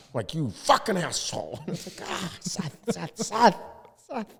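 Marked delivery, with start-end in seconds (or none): 0.3-1.6 higher mock yell of an insult. Owner retelling his own blowup at a vendor, mock angry. 2.1-4.3 high, chiding call of his own name; self-scolding. Retold or imitated. Inner voice chiding Seth after his outburst, high and scolding.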